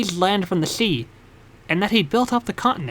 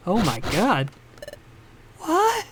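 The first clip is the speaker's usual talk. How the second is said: ordinary talk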